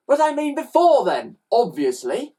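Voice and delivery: put-on high, exasperated child; snappy boy. A narrator's reading. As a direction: start high and loud, drop on the last word. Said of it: "snapped Ned".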